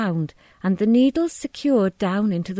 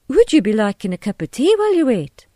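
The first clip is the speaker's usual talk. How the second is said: louder, lilting hostess offer; lightly voiced hostess; polite hostess; 4 semitones higher, 6 dB louder, faster than usual. A narrator's reading. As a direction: offer it brightly in character, higher and louder. Missus Hughes hosts the visitors.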